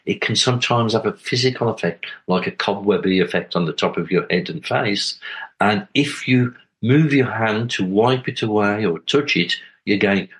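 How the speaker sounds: ordinary talk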